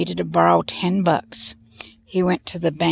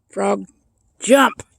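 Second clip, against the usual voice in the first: raised, punchy command on the last word; frog command; 3 semitones higher, 3 dB louder than usual. Retold or imitated. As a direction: order a frog to leap. Joke character commands the frog.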